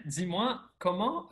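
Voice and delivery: usual speaking voice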